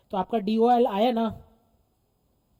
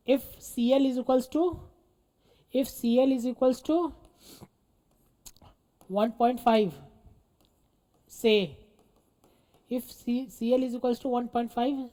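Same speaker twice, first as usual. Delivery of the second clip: slow, deliberate dictation; much slower than usual. Real, not acted. Teacher dictating the assumed CL value to students, slow dictation. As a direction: hand it over in short phrases with pauses.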